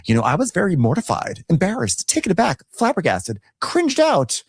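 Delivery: high, hammed-up rambling apology; mocking Drew. Drew apologizing to Shamia, mock dramatic high rambling. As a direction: gush nonsense excuses, squealing at the end. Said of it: "Drew was like".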